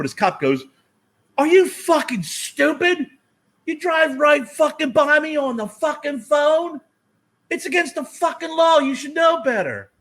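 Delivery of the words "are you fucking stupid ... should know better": high, loud, drawn-out furious rant; screaming cop. Retold or imitated. Cop screaming at a driver caught on the phone, furious and high. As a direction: scream it like an outraged traffic cop. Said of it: "he was screaming at me".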